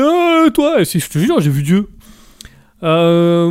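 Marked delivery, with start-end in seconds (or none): 0.0-0.8 high mock call from above; pointing God voice. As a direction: call out much higher, singling someone out.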